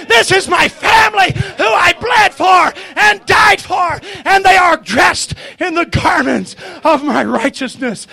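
loud, urgent revival exhortation; impassioned preaching. Real, not acted. Preacher challenging congregation to believe tonight, fervent. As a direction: challenge the hall at full voice.

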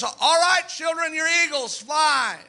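put-on mother eagle hollering at her young to fly. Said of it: "she hollers".

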